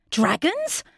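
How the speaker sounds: surprised. Acted. King hears news of dragons.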